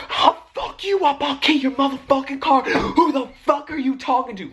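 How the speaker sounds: high, laughing mock screaming threat; retelling how she went off on the driver, high laughing mock fury; mock rage. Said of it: "Literally going off".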